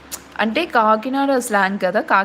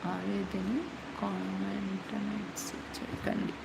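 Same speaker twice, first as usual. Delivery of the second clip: quiet repeat; 2 semitones lower, 17 dB quieter, much slower than usual. Real, not acted. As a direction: say it again under your breath. Repeats the aside when asked. (మెల్లగా మళ్ళీ చెప్పడం)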